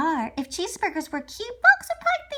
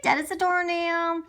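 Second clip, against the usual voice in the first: plain reading voice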